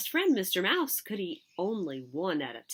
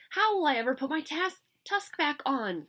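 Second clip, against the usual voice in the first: wailing; 8 semitones higher, 3 dB louder than usual. A narrator's reading. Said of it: "Ganesha wailed".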